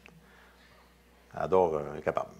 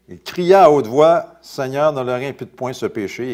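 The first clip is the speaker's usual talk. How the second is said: loud cry; 3 semitones higher, 10 dB louder than usual. A narrator's reading. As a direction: belt it out like the scripture's cry.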